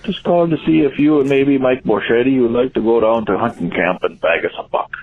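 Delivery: folksy Upper Midwest Yooper caller; small-town Yooper accent, rambling invitation; Upper Wisconsin accent. Acted. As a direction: put on a broad northern Wisconsin accent. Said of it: "Christopher Guest doing a character".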